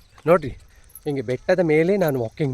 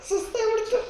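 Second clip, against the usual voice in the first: laughing; 8 dB quieter than usual. Real, not acted. Laughing over tired dog.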